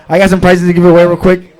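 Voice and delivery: ordinary talk, nothing marked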